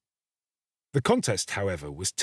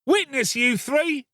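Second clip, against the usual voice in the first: put-on boy crying out; 14 semitones higher than usual. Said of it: "cried the boy".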